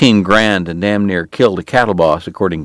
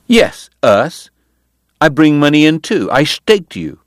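indignant woman; 7 semitones higher than usual. Acted. Kate quarrels with Doc.

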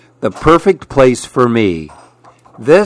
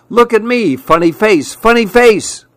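higher, sing-song funny-face call; silly taunt; 9 semitones higher than usual. Acted. Lizard clowning for the animals, high sing-song taunt. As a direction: clown around to get noticed.

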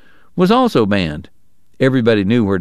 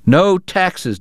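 cried out; 7 semitones higher than usual. Acted. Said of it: "Deacon cried".

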